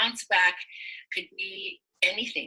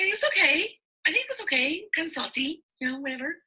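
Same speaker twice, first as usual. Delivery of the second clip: high-pitched imitation of an unsure friend; noncommittal friend; 9 semitones higher than usual. Friend can't remember her lunch, high and offhand. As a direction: shrug it off in a higher voice.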